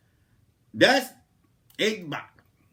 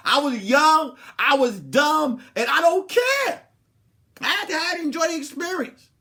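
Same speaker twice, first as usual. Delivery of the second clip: higher and louder, owning it; defiant; 10 semitones higher, 7 dB louder than usual. Real, not acted. Defiant confession to viewers.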